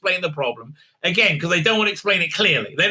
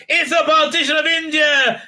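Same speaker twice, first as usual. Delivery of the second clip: mock panic; 11 semitones higher, 4 dB louder than usual. Mocking the doctor's panicked line.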